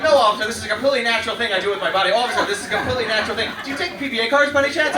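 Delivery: fast, loud, high protest to the officer; hammed-up plea to a police officer; mock pleading.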